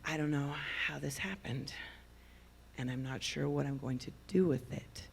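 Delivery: hushed, confiding secret; conspiratorial. Retold or imitated. Daughter retells her mother's confession in the car, conspiratorial. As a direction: imitate someone softly letting you in on a secret. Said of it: "she was very conspiratorial".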